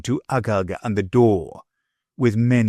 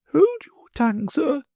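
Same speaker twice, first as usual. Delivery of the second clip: usual speaking voice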